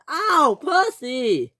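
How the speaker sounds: slow, high wail; pained complaint. A narrator's reading. Bash hurt by Percy, pained complaint. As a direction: whine it out in pain.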